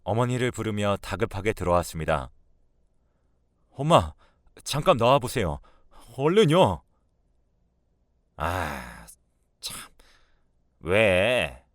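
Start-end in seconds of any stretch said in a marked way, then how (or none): 3.7-6.8 urgent, raised call to mother; agitated son, higher and louder at the end. A narrator's reading. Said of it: "갑자기 잔뜩 흥분된 목소리로". 8.4-11.6 low, grudging answer; lightly voiced older woman, put out; irritated mother-in-law. Mother irritated at being called.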